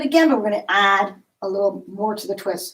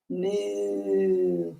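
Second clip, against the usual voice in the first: low, soft cow moo; quiet cow noise for the kids; 2 semitones lower, 8 dB quieter than usual. Pretend. Cow pose, kids yoga.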